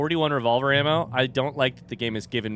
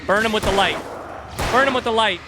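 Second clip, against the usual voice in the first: urgent; 10 semitones higher, 7 dB louder than usual. Real, not acted. Fighting enemy with flashlight.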